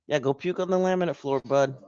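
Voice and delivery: high, quick pet-directed voice; talking to a dog. Real, not acted. Owner sending the pug to puke, pet-directed voice.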